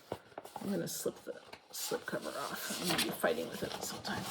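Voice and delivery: soft whispered aside while fumbling. Real, not acted. Whispering to herself while pulling off the slip cover.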